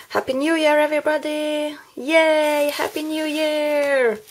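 festive, high-spirited hello; excited greeting. Real, not acted. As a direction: cheer it to your viewers. Cheerful New Year wishes to viewers.

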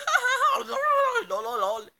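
loud mock cackle settling into chant; hammed-up laughter. Reading his typed laughter aloud, hammed-up laughing. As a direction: read out typed laughter as a big fake laugh. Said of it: "And then he was like".

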